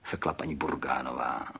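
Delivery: ordinary delivery, nothing marked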